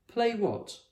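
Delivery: high, quick question; curious Fred. A narrator's reading. Fred glad to stop scaling fish, curious. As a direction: ask it with interest. Fred voiced in read story.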